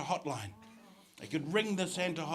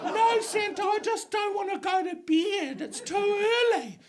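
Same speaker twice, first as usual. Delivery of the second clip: put-on little girl; 4 dB louder than usual. Child pleading with Santa.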